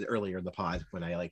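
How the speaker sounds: usual speaking voice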